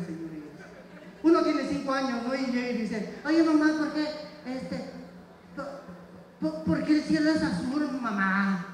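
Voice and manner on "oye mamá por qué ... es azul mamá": put-on child. Child voiced asking mom.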